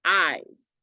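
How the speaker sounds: "Eyes" with the "I" stretched and the S only at the very end; long, falling "Eyes"; long I sound. Shown as a demonstration. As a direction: draw out the vowel of "Eyes", short S to finish. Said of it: "That I sound goes on very far".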